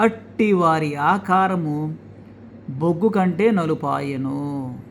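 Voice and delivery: reads the verse slowly and gravely; slow scripture reading. Acted. Preacher reading scripture to his viewers, slow and grave. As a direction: let each word of the verse land slowly.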